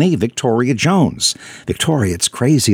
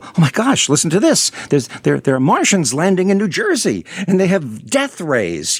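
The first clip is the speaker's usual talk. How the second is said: higher, alarmed radio listener voice; panicked listener; 6 semitones higher than usual. Retold or imitated. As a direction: play a startled listener hearing the news. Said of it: "and they go".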